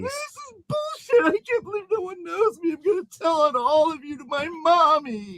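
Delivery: high weepy tantrum; crying whine. Acted. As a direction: blubber like a child threatening to tell mommy. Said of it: "He whined".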